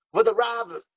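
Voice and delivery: put-on "Votre Rav" in an English accent; French with an English accent. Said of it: "pourquoi je fais un accent anglais?".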